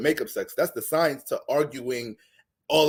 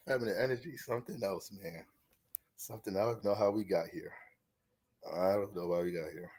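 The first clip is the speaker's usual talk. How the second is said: quiet, lost trail-off; 8 semitones lower, 12 dB quieter than usual. Real, not acted. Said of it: "trailing off lost".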